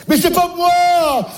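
high, slow whine of innocence; drawn-out denial. Acted. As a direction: stretch it out pleading it wasn't you. Drawn-out denial, blaming the mother. (plainte traînante et aiguë, c'est pas moi)

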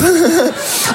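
high, airy little courtesy laugh; a fake polite chuckle for the neighbour. Stand-up mocking his own polite laugh at the neighbour's door. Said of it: "Vous voyez ce rire-là?".

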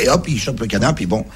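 ordinary delivery

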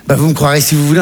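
normal talking voice

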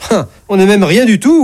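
high-pitched, affronted comeback; indignant God correcting the doctor; indignant retort. Acted.

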